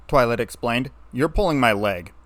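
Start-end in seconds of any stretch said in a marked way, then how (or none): none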